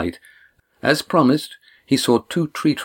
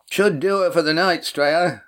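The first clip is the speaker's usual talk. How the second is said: shouting across water; 9 semitones higher than usual. A narrator's reading. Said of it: "Cam called from the shore".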